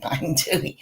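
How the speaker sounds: ordinary delivery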